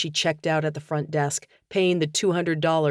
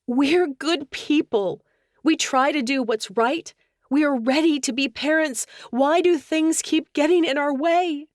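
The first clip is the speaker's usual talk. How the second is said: anguished; 10 semitones higher, 3 dB louder than usual. Acted. Anguished plea to husband.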